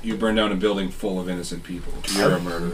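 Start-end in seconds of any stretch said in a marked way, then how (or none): 2.1-2.7 high, accusing prophet voice; accusing stranger. Acted. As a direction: point and condemn.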